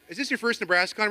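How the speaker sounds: usual speaking voice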